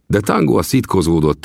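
usual speaking voice

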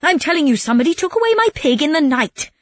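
high, exasperated acted yell. A narrator's reading. Robbed man insists to his doubting friends, yelling. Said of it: "Calandrino started yelling again".

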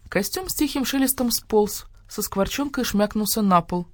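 plain reading voice

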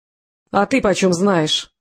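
ordinary delivery, nothing marked

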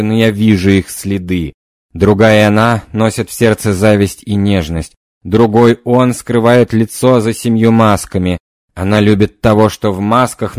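loud trance prophecy; seance medium. Acted. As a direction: proclaim visions in a trance.

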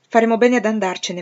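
ordinary delivery, nothing marked

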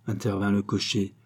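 ordinary delivery, nothing marked

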